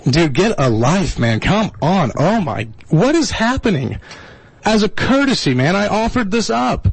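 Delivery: usual speaking voice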